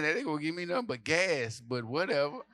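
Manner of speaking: usual speaking voice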